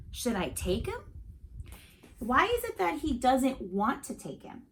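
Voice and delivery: ordinary delivery, nothing marked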